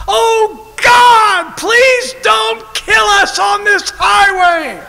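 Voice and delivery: high, loud panicked praying; a terrified kid crying out to God; desperate prayer. Retold or imitated. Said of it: "praying out".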